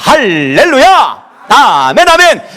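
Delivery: full-force rallying preacher; rally the congregation with loud, forceful calls. Real, not acted. Rallies congregation to pray. (힘차게 외치는 설교)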